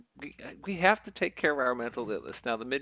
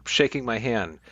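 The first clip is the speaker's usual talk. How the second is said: ordinary talk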